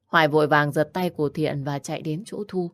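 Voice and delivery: usual speaking voice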